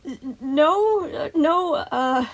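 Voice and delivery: high, stammered denial; Sun, flustered. A narrator's reading. Sun denying a scary rumor to children, flustered. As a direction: falter and fumble for words.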